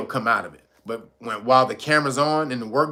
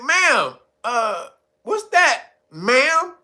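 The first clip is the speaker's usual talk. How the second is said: mock doubtful; 8 semitones higher, much slower than usual. Acts hesitant doubtful reply.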